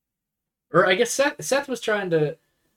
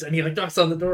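ordinary talk, nothing marked

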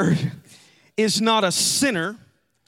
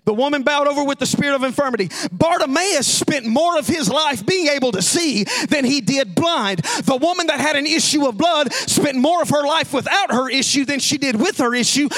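raised, ringing pulpit voice; preaching at full force; 6 semitones higher, 4 dB louder, faster than usual. Acted. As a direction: drive the point home to the congregation. Preaching climax.

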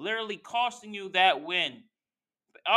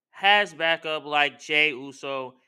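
ordinary talk, nothing marked

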